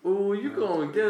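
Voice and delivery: high, drawn-out teasing whoop; mimicked catcall. Co-host mimicking the roommate from the date story, laughing catcall. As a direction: echo the roommate's catcall, amused.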